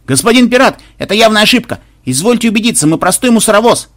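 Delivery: high-pitched, agitated protest; gabbled pleading. A narrator's reading. Garbage-ship captain protesting the mistake to a pirate, high gabbled plea. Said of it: "он затараторил".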